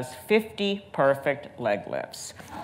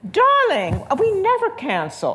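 much higher, louder, grand lady voice; grand dame; 5 dB louder than usual. Retold or imitated. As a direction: sing it out like an imperious society grande dame. Quoting the grand old socialite.

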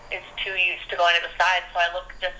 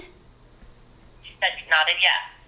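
very slow, drawn-out report; much slower than usual. Real, not acted. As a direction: drag each word out slowly. Relaying toddler's answer slowly.

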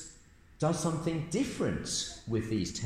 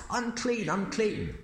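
cry out; 7 semitones higher than usual. Acted. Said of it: "and cry out".

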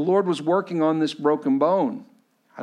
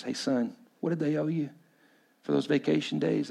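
soft, understated imitation of a dad; gentle father; 5 dB quieter than usual. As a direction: ask your son quietly. Dad gently asks son, acted out.

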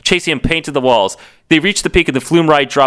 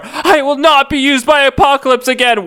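high, anguished declaration; hammed-up tormented hero; crying out; 12 semitones higher than usual. A narrator's reading. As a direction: cry out in mock torment. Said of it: "Warren cried out".

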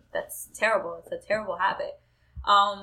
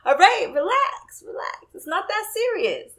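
put-on teasing boyfriend; 11 semitones higher, 5 dB louder than usual. Boyfriend calming her teasingly.

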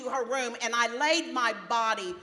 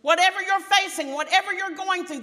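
high, loud pulpit proclamation; full-force preaching; 9 semitones higher, 8 dB louder than usual. Real, not acted. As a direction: preach it out to the whole congregation.

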